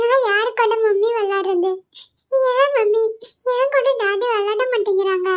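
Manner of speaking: put-on high, whining child's sob; tearful complaint. Lonely child asks mother why daddy won't play, tearfully. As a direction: plead in a small, crying voice.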